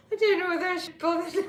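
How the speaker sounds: giggling out the words in a rush; laughing. Real, not acted. Streamer doubting her live stream while laughing, fast and loud. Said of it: "It's so funny".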